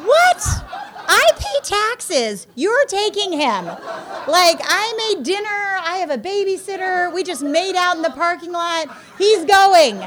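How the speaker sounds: high, loud laughing outrage; indignant rant. Retold or imitated. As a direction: demand it like a furious taxpayer, half laughing. Ranting at jail staff, retold.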